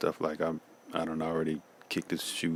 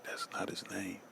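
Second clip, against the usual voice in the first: whispered correction; 3 semitones higher, 10 dB quieter than usual. Retold or imitated. Correcting father in emergency.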